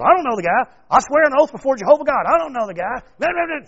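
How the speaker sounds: panicked denial. Acted. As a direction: Peter cornered, denying everything in a panic. Peter denying Jesus before a girl.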